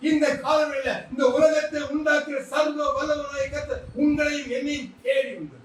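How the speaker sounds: rising preacher's call, full force; fervent preaching. Acted. Preacher proclaims to his congregation, fervent and rising. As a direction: drive the promise home to the crowd.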